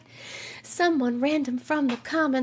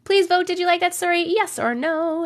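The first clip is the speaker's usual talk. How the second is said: ordinary talk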